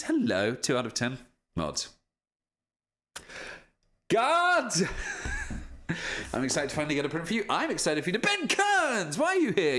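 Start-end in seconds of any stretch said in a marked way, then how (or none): 4.1-6.3 playful shout for guards; mock call for guards. 8.2-9.8 high, loud happy shock; high, loud surprised welcome to Ben Kearns; delighted surprise. Real, not acted. Surprised at viewer's arrival.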